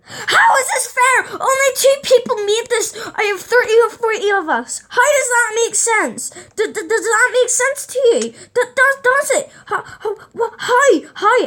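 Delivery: high, loud, tearful outcry; outraged protest. Retold or imitated. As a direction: wail that this is unfair, voice cracking. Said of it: "and we're like".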